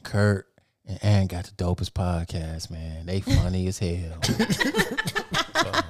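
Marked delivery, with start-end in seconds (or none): 0.0-4.2 very low, slow, soft praise; hushed, unhurried deep mumble; smooth, unexcited guy. Retold or imitated. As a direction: imitate him deep and unbothered. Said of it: "He was like".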